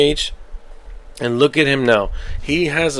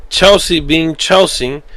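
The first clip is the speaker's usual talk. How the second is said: ordinary talk, nothing marked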